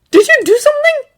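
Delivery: high, loud surprised question; astonished. A narrator's reading. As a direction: ask in disbelief. Astonished question mid-fight.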